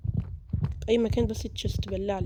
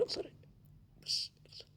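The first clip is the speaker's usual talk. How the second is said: hushed whisper; 19 dB quieter than usual. Real, not acted. Sneaking past a monster.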